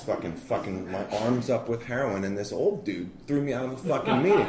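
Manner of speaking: usual speaking voice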